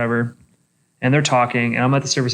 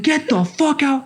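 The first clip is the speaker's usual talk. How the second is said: high-pitched angry order, not shouted; indignant retold command; retold freak-out; 11 semitones higher than usual. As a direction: act out throwing someone out, voice raised in pitch. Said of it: "I am flipping shit".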